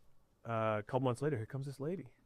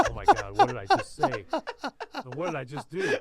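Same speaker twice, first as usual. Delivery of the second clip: shocked; 12 semitones higher, 8 dB louder than usual. Retold or imitated. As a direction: voice jumps up in panic. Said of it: "And I was like".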